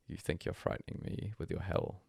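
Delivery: usual speaking voice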